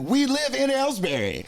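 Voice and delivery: high, drawn-out delighted exclamation; imitated excited surprise. Strangers discover he is from their town, excited astonishment. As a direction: mimic strangers amazed by the coincidence. Strangers thrilled at shared hometown.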